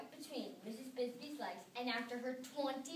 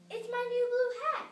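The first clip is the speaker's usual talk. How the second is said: tiny voice; 11 semitones higher, 8 dB louder than usual. A narrator's reading. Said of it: "she said in a tiny voice".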